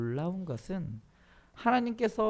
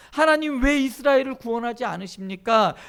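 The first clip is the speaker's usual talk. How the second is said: loud, raised protest to God; Gideon's outcry; 5 semitones higher, 8 dB louder than usual. Acted. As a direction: demand an answer at high pitch. (목소리를 높여 따져 묻기)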